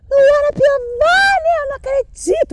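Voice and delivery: imitating a screaming fan who spots a celebrity. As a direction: scream her name like a starstruck fan. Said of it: "começaram a gritar".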